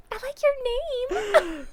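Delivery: very high imitated sweet compliment; high-pitched mock compliment; sister's awkward compliment. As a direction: mimic a sister reaching for something nice, way up high. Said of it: "I'm gonna try to compliment this lady".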